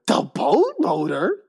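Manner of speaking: slow, drawn-out disbelief; slow incredulous repeat; incredulous echo. Retold or imitated. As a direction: repeat it like it's absurd. Repeating her absurd answer.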